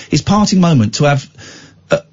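ordinary talk, nothing marked

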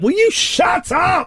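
high exasperated shout; shouting at kids. Retold or imitated. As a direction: yell it at the kids. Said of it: "To the kids, it's like".

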